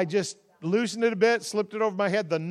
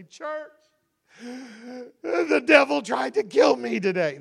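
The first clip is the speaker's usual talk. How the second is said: mock tearful lament; 6 semitones higher, 3 dB louder than usual. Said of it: "burst into tears and say".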